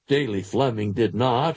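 ordinary delivery

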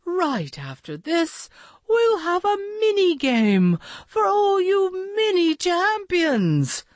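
high, cheery duck voice; smiling duck voice. A narrator's reading. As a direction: quack it out with a smile. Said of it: "with a smile in his quack".

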